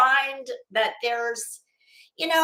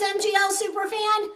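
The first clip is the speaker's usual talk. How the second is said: higher, surprised question; surprised repeat; 8 semitones higher than usual. Real, not acted. Reading a viewer's name back in surprised disbelief.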